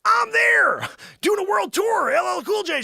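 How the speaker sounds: high, loud hammed-up boast; mock-outraged bragging yell; indignant shout. Indignantly claims Magellan's feat.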